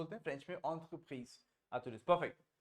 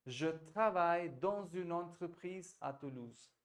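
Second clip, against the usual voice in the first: the whole sentence said slowly; slow repeat-after-me; slower than usual. Shown as a demonstration. Teacher models the full sentence for Tarun to repeat. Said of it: "Repeat after me the whole thing".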